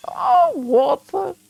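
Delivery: high, slow, melting Oh, Walter; swooning over her husband, slow and high; tender sigh. Acted. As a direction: stretch his name out like you adore him. Wife reacts to husband's news.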